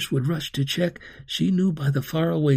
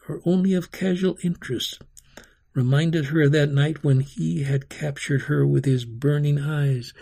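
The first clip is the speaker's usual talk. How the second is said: usual speaking voice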